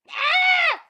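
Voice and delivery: sudden high shout of frustration; real scream of frustration at the race; frustrated scream. Real, not acted. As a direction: let out a piercing yell of exasperation.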